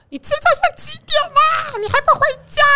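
high shrill-pitched mock mom scolding; imitating mom yelling. Mother scolding her teen in front of friends, imitated. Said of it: "咆哮我".

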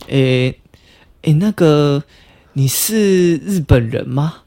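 slow, hesitant imitation of Japanese intonation; Japanese-style speech. Asking the stranger if he is Japanese, imitated Japanese accent. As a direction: mimic a Japanese speaker, drawing the words out. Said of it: "为什么你还要学日本人讲话".

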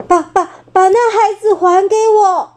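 shaking uncle facing a monster; trembling demand. Acted. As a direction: demand her back through fear. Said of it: "霸王龙发着抖".

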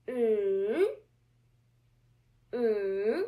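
"Mm" in a long swoosh, down then up; big third tone. Shown as a demonstration. Teacher drawing a big Nike with sound, long dipping hum. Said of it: "you are making a big Nike".

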